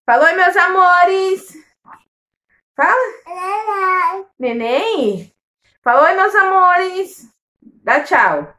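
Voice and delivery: high, slow sing-song coaxing. Real, not acted. Mother urging her toddler to greet the viewers, slow high baby talk. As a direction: draw the words out sweetly for a little one.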